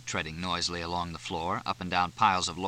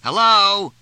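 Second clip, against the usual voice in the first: loud, high shout over the noise; 12 semitones higher, 11 dB louder than usual. A narrator's reading. Fletch shouting through the screen door, loud. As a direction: shout to be heard inside. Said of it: "he shouted".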